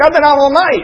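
loud, high-pitched complaint; acted weary protest. Peter objecting to going fishing again, in mock complaint.

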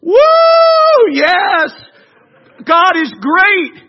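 loud, high whoop of praise; jubilant, drawn-out acclamation; exultant cheering. Acted. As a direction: shout praise like a rejoicing crowd. Voicing the people cheering God.

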